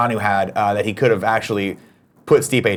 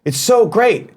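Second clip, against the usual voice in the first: high, loud gushing; 10 semitones higher, 3 dB louder, slower than usual. Retold or imitated. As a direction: gush over it like a fan. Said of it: "Everyone's like".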